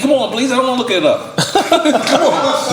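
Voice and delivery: laughing plea to skip looking it up; pleading. Real, not acted. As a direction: beg him playfully to just explain. Begging friend to explain.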